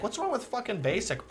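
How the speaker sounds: ordinary talk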